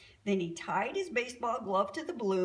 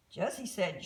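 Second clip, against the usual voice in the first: ordinary delivery, nothing marked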